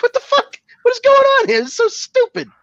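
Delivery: very high, strained disbelief; exasperated squeal. Retold or imitated. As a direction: pitch it way up in mock exasperation. High exasperated reaction to film.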